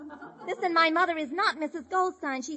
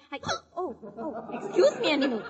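ordinary talk, nothing marked

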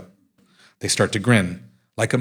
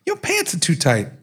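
lightly raised, mock girlfriend voice; a hint of a scolding girlfriend, pitched up; high-pitched female voice; 8 semitones higher than usual. Girlfriend complaining about his pants, lightly mimicked high voice. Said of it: "he mimics a high-pitched female voice".

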